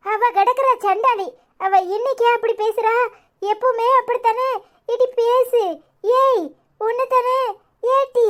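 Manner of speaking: high, urgent calling down the phone; insistent calling. Acted. Aunt calling Aishwarya who won't answer, urgent and high. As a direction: call out repeatedly, demanding an answer.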